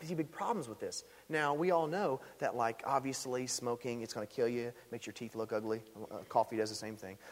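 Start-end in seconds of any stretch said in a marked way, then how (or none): none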